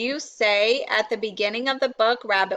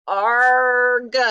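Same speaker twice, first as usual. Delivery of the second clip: loud "arg" of frustration; loud storybook groan; frustrated growl; 5 dB louder than usual. A narrator's reading. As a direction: burst out in exasperation. Rabbit frustrated by search.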